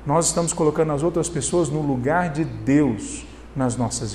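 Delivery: low, soft sermon voice; pregação em tom grave. Real, not acted. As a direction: drop low and quiet to press the point. Preacher drops to solemn register. (pregação contida, em voz baixa e grave)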